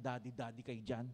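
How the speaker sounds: mock them under your breath; jealous son mocking the youths in his head; sulky inner mockery. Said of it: "Ako naman".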